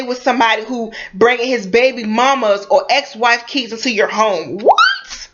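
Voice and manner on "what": shrill squeaked "What?" shooting far up in pitch; incredulous what. Real, not acted. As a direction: screech the word in utter disbelief. Outraged again at the question.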